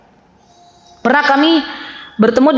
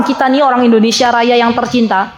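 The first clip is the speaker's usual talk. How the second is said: normal talking voice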